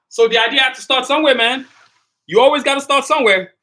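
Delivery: loud, high motivational call; fired-up shout. Real, not acted. Streamer rallying his viewers, loud and fired up. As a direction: pump the viewers up at full voice.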